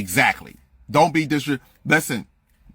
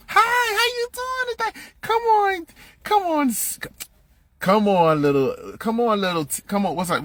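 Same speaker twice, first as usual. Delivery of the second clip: very high, sing-song teacher voice; hammed-up syrupy teacher voice; mock sweet teacher; 12 semitones higher than usual. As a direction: mimic a fake-nice teacher greeting a little kid. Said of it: "this is how they fucking talk".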